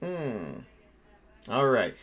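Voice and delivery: ordinary talk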